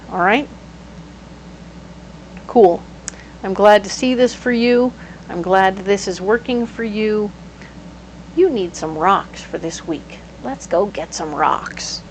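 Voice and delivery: ordinary delivery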